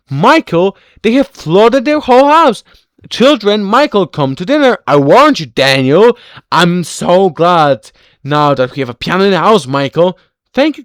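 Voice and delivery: loud household calls; loud calling out. Acted. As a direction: call to everyone at full volume. Loud calling to family, workers.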